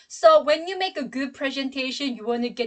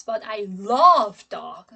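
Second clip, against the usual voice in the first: "love" drawn out and much louder; stress on LOVE; 2 semitones lower, 8 dB louder, slower than usual. Shown as a demonstration. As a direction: swell hard on the word "love". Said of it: "my stress is on love".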